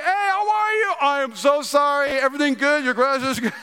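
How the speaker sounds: high, sing-out mock cheerful greeting; overfriendly apology. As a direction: act caught out, covering with friendliness. Embarrassed apology to neighbor, acted out.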